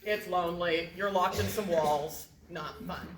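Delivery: low, quiet deadpan list; deadpan misery. Real, not acted. Comedian explains what a siege is like, glum and deadpan.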